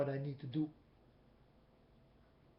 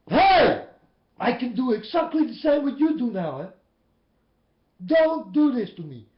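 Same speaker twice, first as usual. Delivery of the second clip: loud, higher retold yell; 5 semitones higher, 13 dB louder than usual. Preacher yelling at the blabbering man to stop. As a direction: shout him down at full volume. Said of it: "I was yelling at this man".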